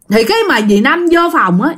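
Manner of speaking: usual speaking voice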